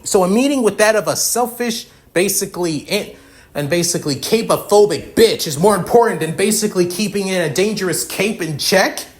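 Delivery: high-pitched, indignant rant; heated, scornful question with hard-hit words; outraged incredulity. Acted. Outraged reply to excuse about meeting.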